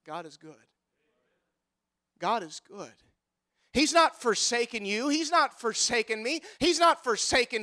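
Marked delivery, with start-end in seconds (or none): 0.1-2.9 much softer, dropped-low refrain; hushed reverent. Acted. Preacher quieting after the climax, soft reverent hush. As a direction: let it fall to a hush.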